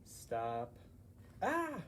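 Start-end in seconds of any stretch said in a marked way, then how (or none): 1.4-1.8 sudden high yelp of surprise; a sharp frightened "ah"; startled cry. Acted.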